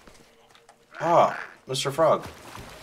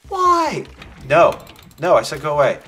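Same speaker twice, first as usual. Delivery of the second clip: loud frantic yelling; panicked yell; 3 semitones higher, 12 dB louder than usual. Real, not acted.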